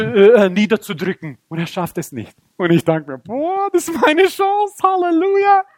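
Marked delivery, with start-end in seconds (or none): 3.2-5.6 very high, drawn-out excitement; thrilled hope. Acted. Dreamer seeing his chance against the giant, high excited voice. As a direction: squeal it with rising hope.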